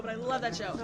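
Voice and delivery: whispered guilty admission; a sheepish whisper; whispered confession. Real, not acted. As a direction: confess it under your breath.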